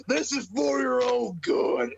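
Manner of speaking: high, drawn-out imitated yell; mock yell. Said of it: "Braun's yelling at him".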